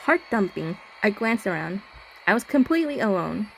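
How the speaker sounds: even, slightly hushed horror narration; story narration. A narrator's reading. Player reading the horror game's narration, slightly hushed. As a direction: read it like a creeping ghost story.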